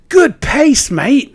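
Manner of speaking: high, cheery voice of the passing runner; mock runner praise. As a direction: imitate the friendly bloke complimenting. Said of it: "and he went".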